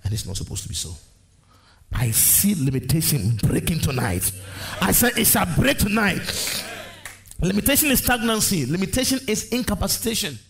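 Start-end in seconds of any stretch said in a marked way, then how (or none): 1.9-10.4 full-force preaching. Real, not acted. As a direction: preach it rising to a high, ringing cry.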